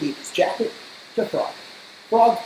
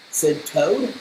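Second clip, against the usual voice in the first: usual speaking voice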